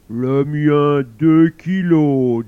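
slow, loud, different voice; one-up the other mum. Acted. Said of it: "sans beaucoup d'entrain". (autre maman, lente et forte)

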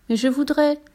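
lightly voiced little devil; pleading little devil. A narrator's reading. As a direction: start a small plea and get cut off.

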